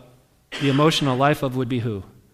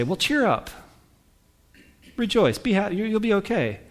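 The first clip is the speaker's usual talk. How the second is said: higher, glib chirpy encouragement; mock cheery advice; 7 semitones higher than usual. Pastor mimicking shallow comforters, chirpy and higher. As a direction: brush it off brightly, like an empty pep talk. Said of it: "sometimes somebody will say".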